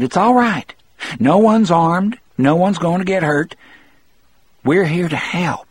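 high, pleading reassurance; urgent reassurance. A narrator's reading. Harry tells the warden no one is armed, pleading reassurance.

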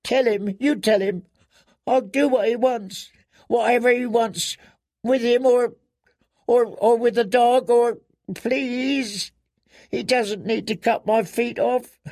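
put-on very high, panicked girl's pleading; terrified girl swearing obedience; desperate begging. A narrator's reading. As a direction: beg frantically, stumbling from phrase to phrase.

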